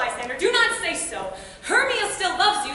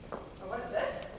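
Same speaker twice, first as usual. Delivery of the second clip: breathless whisper of discovery; whisper, frozen by the sight; hushed alarm; startled whisper; 7 semitones lower, 9 dB quieter than usual. Acted. Helena finds a body.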